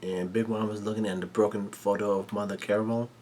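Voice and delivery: ordinary delivery, nothing marked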